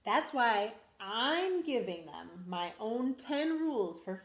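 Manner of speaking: lively storybook read-aloud; animated picture-book reading; reading to children. Acted. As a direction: read it to a class of little kids. Teacher reads picture book aloud.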